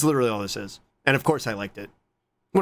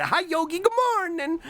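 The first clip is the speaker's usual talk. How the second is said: very high sing-song hello; cutesy greeting; 13 semitones higher than usual. Pretend. Streamer saying good morning to Fuzzy Buzzy, cutesy high voice. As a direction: greet them in a tiny high voice.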